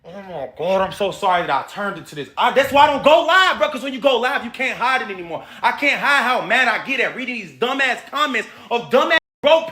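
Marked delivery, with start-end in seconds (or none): none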